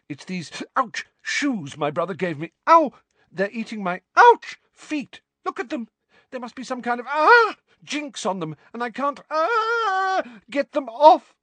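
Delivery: high, loud pained yelps; hopping in pain, voice jumping up; yelping in pain. Acted. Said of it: "in between yelps of pain".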